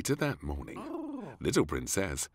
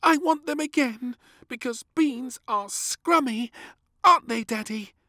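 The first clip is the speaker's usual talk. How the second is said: put-on high, loud eager child; pleading little princess; 8 dB louder than usual. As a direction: win Daddy over.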